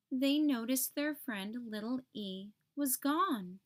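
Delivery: usual speaking voice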